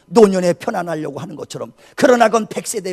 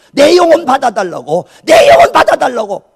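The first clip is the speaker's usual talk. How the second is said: loud, high, insistent plea; desperate pleading prayer; 6 semitones higher, 11 dB louder than usual. Retold or imitated. Retelling a first prayer to God, desperate loud plea. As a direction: cry it out to God, raised and urgent. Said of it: "몸부림치며 기도한 것이".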